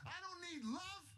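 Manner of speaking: slow, high-pitched mock yell without the volume; retold scream. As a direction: draw out an imitated scream of refusal. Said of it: "And I'm screaming".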